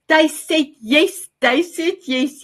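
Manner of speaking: high, excited announcement. Real, not acted. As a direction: burst out with delight. Excited after mock audience answer.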